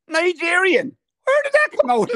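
high-pitched, rising disbelief; astonished punchline. Real, not acted. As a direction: voice soaring in comic amazement. Astonished punchline of DNA result.

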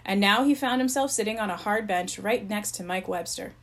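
usual speaking voice

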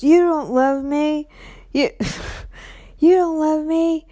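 hammed-up wounded boyfriend; mock wounded yelling. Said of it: "he was just yelling".

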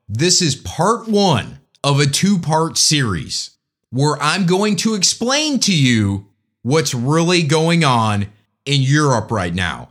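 ordinary talk

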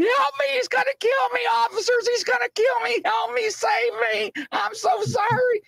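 very high mock screaming; hammed-up wail of terror; panicked cry for help. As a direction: imitate a man crawling away begging to be saved. Panhandler crawls, begging officers for help.

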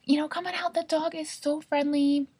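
high, breezy friendly homeowner; cheerful homeowner. Retold or imitated. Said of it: "And they're like".

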